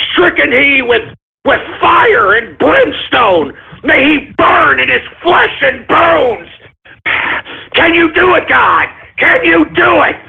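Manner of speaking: hammed-up fire-and-brimstone prayer; mock prayer. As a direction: call down fire from heaven. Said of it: "let's pray".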